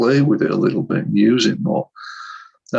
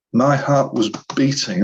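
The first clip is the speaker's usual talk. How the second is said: usual speaking voice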